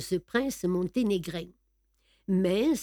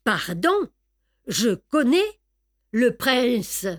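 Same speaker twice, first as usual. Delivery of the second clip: firm, deliberate declaration, pitched up; 3 semitones higher, 4 dB louder, slower than usual. A narrator's reading. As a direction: state it firmly, in a proud Tarascon accent. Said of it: "d'une voix très ferme".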